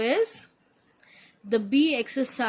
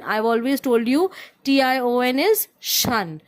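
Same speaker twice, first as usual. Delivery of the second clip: usual speaking voice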